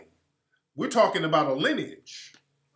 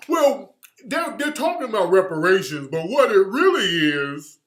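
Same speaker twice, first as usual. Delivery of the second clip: mocking pundit voice; 6 dB louder than usual. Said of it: "when they get on Joy Reid".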